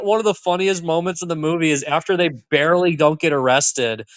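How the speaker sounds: ordinary delivery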